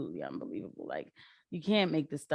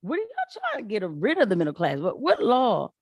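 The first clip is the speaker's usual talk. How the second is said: louder, higher, outraged questions; indignant retort; 6 semitones higher, 4 dB louder than usual. Real, not acted. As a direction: fire back at the clip. Retorting to a played clip.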